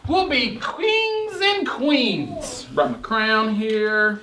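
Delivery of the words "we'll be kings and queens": slow, grand royal announcement; regal proclamation. Acted. Teacher reading Little Bear's royal boast to class, slow and grand. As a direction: proclaim it like a king, drawn out.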